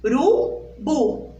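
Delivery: slow "Urubu" in beats; syllable by syllable. Shown as a demonstration. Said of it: "abri a minha boquinha três vezes". ("Urubu" sílaba por sílaba)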